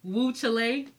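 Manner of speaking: "Woo Chile" mangled as woo-chuh-LAY; mock over-proper pronunciation. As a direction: say "Chile" in two careful syllables, ending in "lay". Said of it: "they say it so properly".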